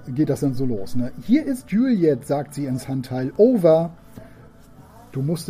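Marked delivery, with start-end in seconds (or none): 1.2-2.3 put-on high, slow child voice; little girl's voice. Daughter speaks into handset. 3.4-3.9 louder, clipped over; girl finishing her first radio message; loud radio sign-off. Acted. Daughter ends radio call.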